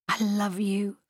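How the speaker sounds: high, tender whisper. A narrator's reading. Mimi to Ed in his arms, high whisper. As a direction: whisper the declaration intimately. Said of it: "she whispered".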